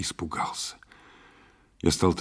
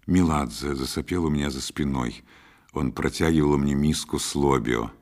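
ordinary delivery

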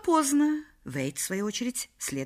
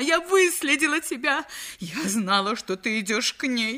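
shout; 7 semitones higher, 3 dB louder than usual. A narrator's reading. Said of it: "закричала она".

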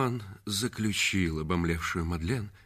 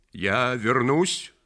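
warning; 4 semitones higher, 6 dB louder, much slower than usual. Acted. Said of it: "предупредил он".

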